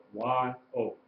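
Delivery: ordinary talk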